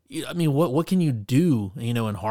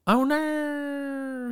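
very high, drawn-out fake Australian wail; bad Australian accent. Imitating the joke about people jumping out, high Australian accent. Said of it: "try to impersonate an Australian accent very poorly".

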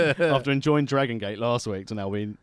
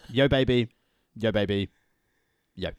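posh voice; 3 dB louder than usual. Pretend. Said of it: "in your posh voice".

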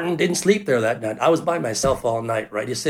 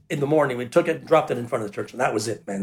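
ordinary talk

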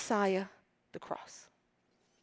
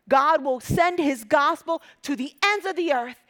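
preaching at full force; 2 semitones higher, 4 dB louder than usual. Acted. Preacher rallying the church at full force. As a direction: build to a rousing cry.

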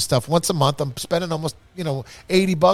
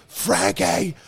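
higher-pitched mock holler; retold scream; 5 semitones higher than usual. Boss yelling for Frankie from the back room, mock scream. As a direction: call out in frustration. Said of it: "I'm screaming".

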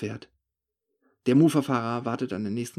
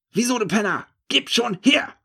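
high, loud out-of-his-mind yell; crazed shout; 10 semitones higher, 5 dB louder than usual. A narrator's reading. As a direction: yell it like a man gone mad. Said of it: "ruft er völlig von Sinnen". (hoher, lauter, irrer Ruf)

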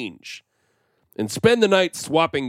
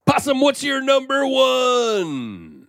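announcer shout; 11 semitones higher, slower than usual. Acted. Host calls on co-host.

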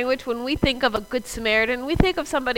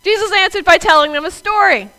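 raised, louder read-aloud voice; storyteller's opening; 7 semitones higher, 6 dB louder than usual. Shown as a demonstration. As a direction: launch the Bible story to the room. Restarts reading as a storyteller.

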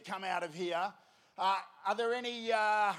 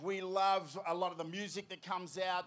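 ordinary delivery

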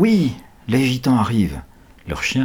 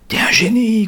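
excited; 14 semitones higher, 5 dB louder than usual. A narrator's reading.

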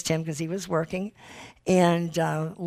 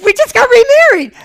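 much higher and louder, bursting with joy; gleeful cry of good news; excited announcement; 12 dB louder than usual. Real, not acted. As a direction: blurt it out thrilled. Announcing the remarriage to in-laws.

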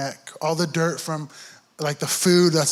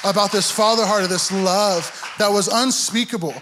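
impassioned preaching; 4 semitones higher than usual. Acted. Pastor lifting the congregation, impassioned preaching. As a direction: preach it out as the music rises.

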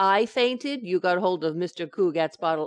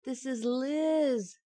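gentle; 4 semitones higher, 4 dB quieter, much slower than usual. Acted. Wife gently reviving fainted husband.

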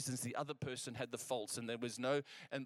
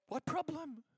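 high-pitched mock-oblivious voice; feigned innocence; 3 dB louder, slower than usual. Preacher mimicking someone blind to their own flaws, feigned innocence. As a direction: play blissfully clueless, pitched way up. Acts out being blind to faults.

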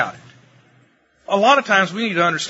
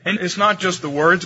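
usual speaking voice

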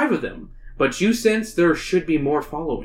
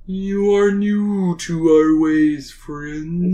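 whale voice; much slower than usual. Acted. Narrator voices the lead whale.

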